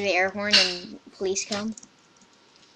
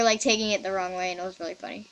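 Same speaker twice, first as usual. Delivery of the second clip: ordinary delivery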